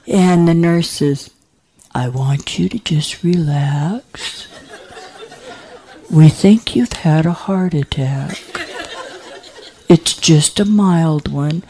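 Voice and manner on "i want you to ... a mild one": calm the patient gently, half laughing; laughing reassurance. Retold or imitated. Nurse calming the patient.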